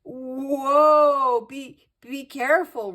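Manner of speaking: high, loud, alarmed stammer; anxious cry. A narrator's reading. Nervous genie in the sky, anxiously crying out. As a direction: cry out in fright and stumble over the words. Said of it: "Rocky said anxiously".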